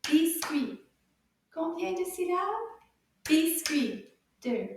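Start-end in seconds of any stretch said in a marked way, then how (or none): 0.0-0.8 BIS-CUIT punched out loud; loud, crisp beats on Biscuit; clapped syllables. Shown as a demonstration. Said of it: "see if we can clap those syllables". 3.2-4.1 BIS-CUIT, one loud beat per syllable; clapped syllables. Shown as a demonstration. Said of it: "clap those syllables".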